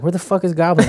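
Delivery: baffled gamer. Retold or imitated. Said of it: "And he was like".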